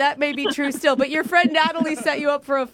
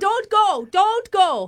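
higher, pleading fake British accent; fake mixed accent; 7 semitones higher, slower than usual. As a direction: beg in a hammy accent. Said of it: "my Australian, Irish, British, Scottish accent".

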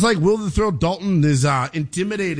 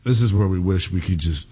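normal talking voice

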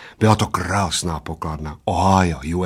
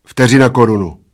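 loud, ready answer; fired off; 2 semitones higher, 7 dB louder than usual. A narrator's reading. Bald man names the stake he had ready, firing it off. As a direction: fire it back at him. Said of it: "vypálil plešatec".

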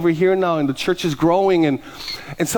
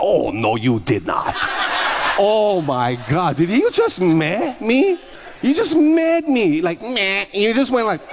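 slow, high, indignant you did not; the pastor acting out his reaction to a man who rolled his eyes at him; sassy mock outrage; 5 semitones higher, slower than usual. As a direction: play it like a diva who just got dissed.

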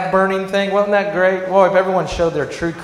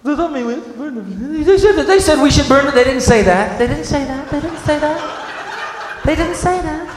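mock confusion; 7 semitones higher than usual. Mock confused citizen then corrections.

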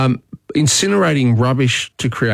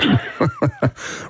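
short burst of genuine laughter; real laugh; 5 dB quieter than usual. Real, not acted. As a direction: laugh at the caller's quip. Host amused by caller's joke.

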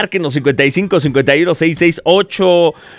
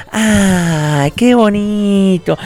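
guests cooing; 4 semitones higher, much slower than usual. Retold or imitated. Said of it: "los invitados dijeron".

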